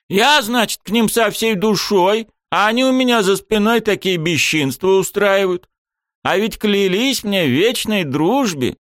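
high, hurt pompous mayor; aggrieved mayor voice. A narrator's reading. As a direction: sulk as the betrayed mayor.

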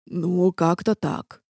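slow, dejected mumble of an answer; glum. A narrator's reading. Half-demon admits how it turned out, glum. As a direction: drag it out dismally. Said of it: "уныло ответил полудемон".

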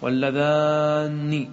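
واللذان with a long-held aa and heavy doubled n; doubled nun. Shown as a demonstration. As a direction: stretch واللذان slowly into a geminated nun. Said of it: "تشدد النون".

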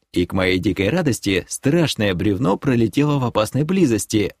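ordinary delivery, nothing marked

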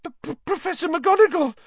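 put-on high, stammering, panicked girl; gasping in fright. A narrator's reading. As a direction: stammer it out in terror. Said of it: "Hermione gasped".

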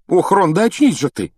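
high-pitched, fervent outburst; heated exclamation. A narrator's reading. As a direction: exclaim it with heat, as if waking someone up. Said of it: "с жаром воскликнула Гермиона".

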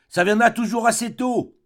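loud, high cry into an ear; formidable shout. A narrator's reading. Cousin Bette shouts into the deaf count's ear, formidable shout. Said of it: "lui cria dans l'oreille ... d'une voix formidable".

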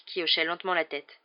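ordinary delivery

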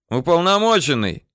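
high-pitched calling out; yelling. A narrator's reading. Bandit calls to the commissioner from hiding, yelling. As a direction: call across the room, high. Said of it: "это орёт тот самый Адик".

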